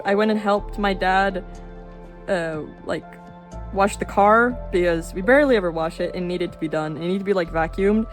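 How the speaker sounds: usual speaking voice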